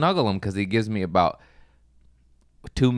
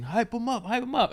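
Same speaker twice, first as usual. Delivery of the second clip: high, soft mock urging whisper-like; hushed urging; 12 semitones higher, 5 dB quieter than usual. Jack Junior urging him from beside the stage, high soft mock urging. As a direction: egg him on quietly from the side. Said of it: "like, saying".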